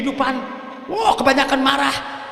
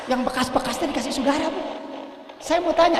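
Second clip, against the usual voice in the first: normal talking voice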